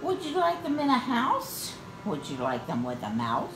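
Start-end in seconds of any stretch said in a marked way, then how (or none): none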